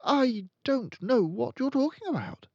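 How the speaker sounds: high, bewildered protest; utterly lost; puzzled. Acted. Boy answering the headmistress, bewildered. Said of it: "more puzzled than ever".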